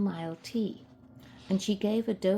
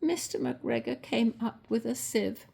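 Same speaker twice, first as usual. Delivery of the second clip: ordinary delivery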